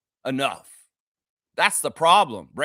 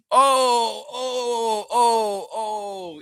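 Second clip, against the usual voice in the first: mock pained moaning; 3 semitones higher, much slower than usual. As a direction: moan like a player milking an injury. Mocking player's pained moans.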